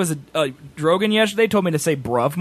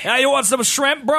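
higher, jokey Aussie-British voice; mock Australian accent; 7 semitones higher than usual. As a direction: offer it in a bad put-on Australian accent.

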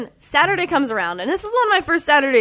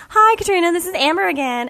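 fake sweet voicemail; 6 semitones higher than usual. Imitating Amber's voicemail.

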